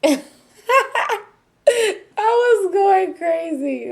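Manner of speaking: high, slow, loud cry through laughter; laughing wail. Real, not acted. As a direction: stretch it out, cracking up at yourself. Laughing at own wild outburst.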